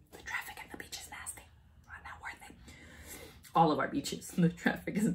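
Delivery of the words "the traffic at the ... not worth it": quick confiding whisper; fast hushed gripe; whispered aside. Real, not acted. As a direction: whisper it as a secret complaint. Whispered aside about beaches.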